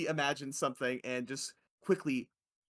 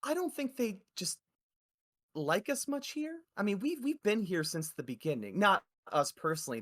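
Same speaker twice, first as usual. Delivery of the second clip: lightly voiced mom, pitched higher; a hint of a hesitant mother, higher voice; 6 semitones higher than usual. Mom explaining why guards accuse them, lightly voiced. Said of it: "the mom says to you".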